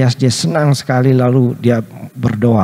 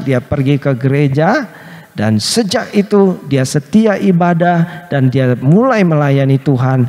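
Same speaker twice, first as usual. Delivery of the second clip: ordinary talk, nothing marked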